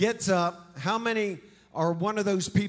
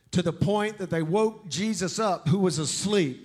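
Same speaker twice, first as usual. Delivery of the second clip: preaching with rising force; building sermon narration; 4 dB louder than usual. Acted. Preacher to congregation, building force. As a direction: drive the storm story home to the congregation.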